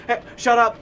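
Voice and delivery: loud, high mock kid; special kid's outburst. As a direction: blurt it at a stranger, imitating the kid. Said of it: "he would say that to people".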